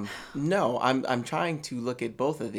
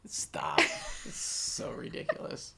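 whisper; 12 semitones lower, 7 dB quieter, slower than usual. Real, not acted. Embarrassed at puppet joke.

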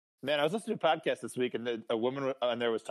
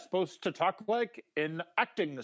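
higher, precise, over-enunciated; crisp over-enunciated actor voice; David Hyde Pierce; 6 semitones higher than usual. Pretend. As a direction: speak like a prim sitcom psychiatrist. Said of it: "that was a really good David Hyde Pierce".

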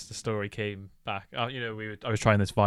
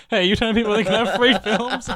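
mock yell; 11 semitones higher, 9 dB louder than usual. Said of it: "came over to yell at you".

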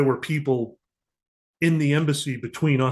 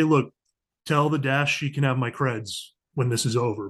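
ordinary talk, nothing marked

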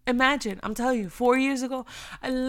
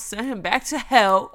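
ordinary talk

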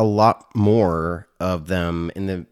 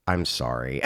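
low, soft imitated apology; mock sheepish apology; 8 semitones lower, 7 dB quieter than usual. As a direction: drop low and small, imitating contrition.

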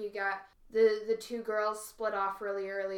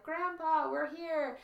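higher-pitched imitation of excited calling; half-hearted yell, pitched up; mock kids' yell; 8 semitones higher, slower than usual. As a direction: call out like arriving kids, without real volume. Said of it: "two kids run out yelling".

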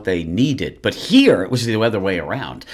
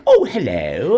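put-on British accent; slower than usual. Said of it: "she still had the accent".

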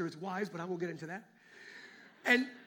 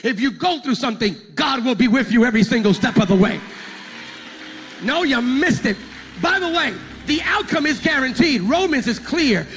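loud, driving pulpit preaching; full-force preaching; 5 semitones higher, 17 dB louder than usual. Real, not acted. Preacher assuring the church the outcome is guaranteed, full-force preaching. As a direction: preach it hard to a big crowd.

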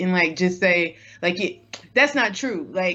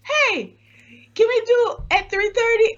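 much higher cartoonish voice, quoting the host's imitation; mock squeaky voice. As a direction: throw his silly high impression back at him. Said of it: "use this voice".

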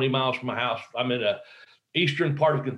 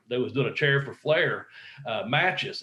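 ordinary talk, nothing marked

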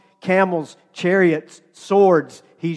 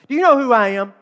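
higher, louder "know" stressed in outrage; mock-affronted challenge; indignant demand; 6 semitones higher, 4 dB louder, faster than usual. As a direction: bark it like an offended VIP. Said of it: "That's what he wants to say".

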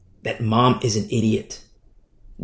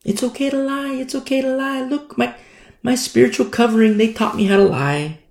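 imitated daughter; 11 semitones higher than usual. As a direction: parrot the parents in a small high voice. Grown baby girl voiced.

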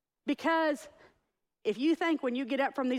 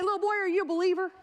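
mock questioning; 6 semitones higher, faster than usual. Acts what the nurse didn't say.

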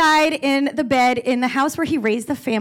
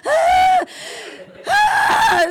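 hysterical wail. Retold or imitated. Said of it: "we're all very like".

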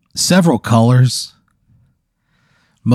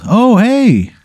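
much higher, louder, friendly creature voice; cheery snooze monster; 12 semitones higher, 5 dB louder, much slower than usual. Acted. Snooze monster greeting Pat with a high, chummy voice. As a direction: greet him like an old buddy, high and bright.

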